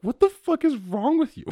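high squeaky mock outrage; incredulous friends. Friends scold him in a high squeaky mock outrage.

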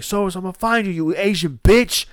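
acted jeer, punching the final word; imitated taunt. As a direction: mimic a kid hurling an insult. Acting a kid's yelled insult.

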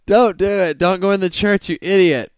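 high, loud yell at the TV; mock drunk yelling. Joke's tipsy wife yelling at the TV, loud mock yell. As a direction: holler a frantic warning at the screen. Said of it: "yelling at the T V".

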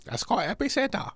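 high-pitched put-on Chinese accent; mock Chinese accent. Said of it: "talk like a Chinese".

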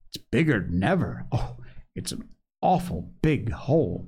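plain reading voice

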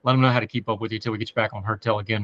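ordinary talk, nothing marked

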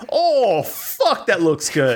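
a Brooklyn guy hungry over the pot; Brooklyn accent. Acted. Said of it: "that was a very Italian Brooklyn guy".

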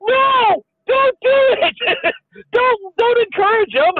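high, loud yelled plea from another room; shouted protest. Retold or imitated. Anne in the other room, yelling to stop dad's plan, high and loud. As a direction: shout it through the wall, begging him not to. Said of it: "Anne's in the other room's going".

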